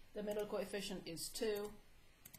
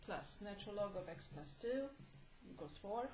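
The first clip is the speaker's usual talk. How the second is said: hoarse, tired teacher voice; strained voice, quietly worn; losing voice; 6 dB quieter than usual. Real, not acted. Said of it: "I'm not sure why I'm losing my voice".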